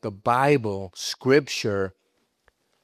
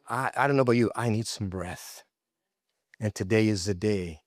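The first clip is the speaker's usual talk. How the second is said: ordinary talk, nothing marked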